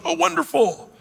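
high mock "Oh, wonderful". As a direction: gush like an easily fooled believer. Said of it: "other Christians going".

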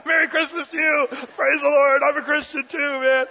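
high, loud, gushing Merry Christmas; excited relief. Retold or imitated. Cashier lights up, relieved fellowship.

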